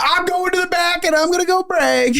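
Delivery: very high, squeaky, giddy announcement; flustered and delighted, escape to the back; overwhelmed waitress. Retold or imitated.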